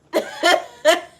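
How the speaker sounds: loud high cackle; high laugh. Real, not acted. Laughing at the thought of rebirth, high and loud. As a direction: crack up laughing.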